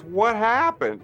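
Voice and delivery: high, drawn-out mock yell; director John's outburst. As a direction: imitate a director's appalled, high-pitched question. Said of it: "You know, like that".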